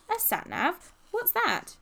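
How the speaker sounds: high-pitched puzzled echo; baffled question. A narrator's reading. Polly asking Ben about the gadget, baffled. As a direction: query a word you have never heard.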